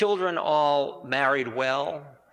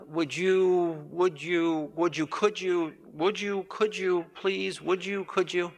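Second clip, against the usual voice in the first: mock pleading; 5 semitones higher, slower than usual. Mocking endless requests.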